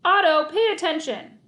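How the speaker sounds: angry coach shout; yelled. A narrator's reading. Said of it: "he yelled".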